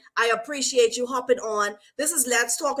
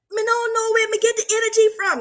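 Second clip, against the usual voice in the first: higher, excited Jamaican accent imitation; 9 semitones higher than usual. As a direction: play the client amazed, in a Jamaican accent. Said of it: "This Jamaican".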